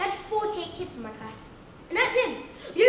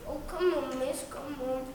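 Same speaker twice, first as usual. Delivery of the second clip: softer pleading whine; whiny kid; 4 semitones lower, 5 dB quieter than usual. Acted. Whiny kid begging miss, quiet whining plea. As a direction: plead under your breath like a pupil. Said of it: "The whiny ones".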